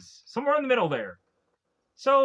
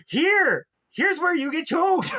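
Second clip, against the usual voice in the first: loud high hammed-up villain taunt; villain threat; 7 semitones higher, 4 dB louder than usual. Ezekiel taunting his victims, loud and high hammy. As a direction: ham up a cartoon baddie's threat.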